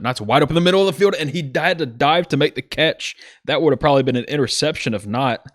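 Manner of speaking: fast, amped play-by-play; excited call. Real, not acted. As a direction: rush the diving catch with energy. Diving catch called live.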